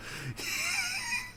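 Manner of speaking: very high, thin squeaking laugh; squealing laugh at his own zaniness. Real, not acted. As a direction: let out a giddy falsetto squeal.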